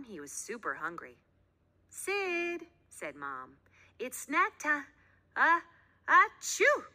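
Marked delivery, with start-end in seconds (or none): 1.9-3.6 halting words that burst into a loud sneeze; building up to a sneeze. A narrator's reading. Mom sneezes. 4.0-6.9 words halted then a loud high achoo; sneeze interrupting. A narrator's reading. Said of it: "mom sneezes mid sentence".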